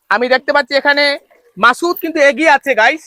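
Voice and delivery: building from lively to shouted commentary; booming prize announcer. Real, not acted. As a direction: call the finish like a sports announcer. Live race commentary building.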